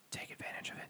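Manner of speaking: whispered aside, barely audible; conspiratorial whisper. Pretend. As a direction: slip it in as a secret, all breath. Covert aside after a disclaimer.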